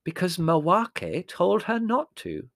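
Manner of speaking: slow, high triumphant retort; drawn-out note of triumph. A narrator's reading. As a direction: deliver it as a winning point. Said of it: "said Prue triumphantly".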